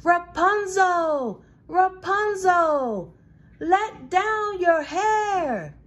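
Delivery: high, loud, slow call drawn out; witch calling up. Acted. Witch calling up to Rapunzel from below, high and loud. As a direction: haul each word up the tower wall. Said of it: "and called out".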